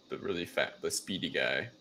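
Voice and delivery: normal talking voice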